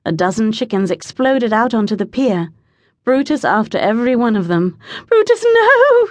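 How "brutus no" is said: high, urgent shout; loud cry of alarm, pitched way up; yelled. A narrator's reading. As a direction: yell it at a runaway dog. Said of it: "she yelled".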